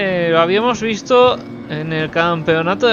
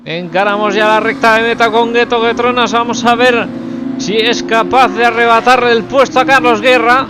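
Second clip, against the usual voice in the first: motorsport commentator; 3 semitones higher than usual. Real, not acted. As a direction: build the call up to the finish. Pole lap crossing the line.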